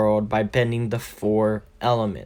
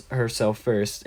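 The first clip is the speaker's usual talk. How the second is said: plain reading voice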